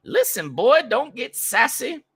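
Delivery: put-on high, slow Southern old-lady scolding; thick Southern accent. Rich old woman putting the young guard in his place, Southern accent. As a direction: draw out the warning in a high, loud Southern accent. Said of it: "Thick Southern accent".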